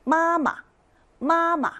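마마 high and level, then falling on the second syllable; first tone then neutral. Shown as a demonstration. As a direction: hold the first 마 high, drop the second short and light. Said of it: "떨어집니다".

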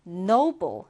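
noble with a long O and a B; O and B sounds. Shown as a demonstration. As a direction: say "noble" as NOH-bul, with a clear B. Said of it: "O 발음 그리고 B 발음 해주시면 됩니다". (noble을 '오'와 B 소리로 또박또박)

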